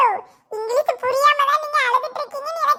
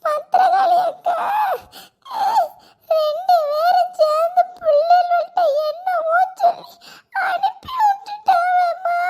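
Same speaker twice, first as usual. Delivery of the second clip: higher, drawn-out crying complaint; tearful wailing; 4 semitones higher than usual. Acted. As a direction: tattle through sobs, ending in a wail. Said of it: "எதுக்குமா அழுக".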